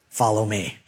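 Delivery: low, understated command; God. Acted. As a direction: answer the panic with deep calm. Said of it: "And God said".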